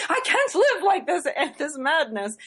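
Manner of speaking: high, drawn-out playful outcry breaking into laughter; hammed-up despair with a laugh; laughing mock exasperation. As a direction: laugh through a mock-dramatic complaint, pitched high. Retelling own angry inner thought.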